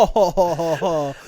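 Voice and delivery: big hammy ho-ho laugh; booming theatrical laughter; loud mock laugh. Real, not acted. Exaggerated laughing burst.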